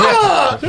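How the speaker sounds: startled yelp. Acted. Pirate startled, slams door.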